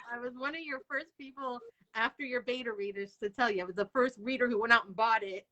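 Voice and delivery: ordinary delivery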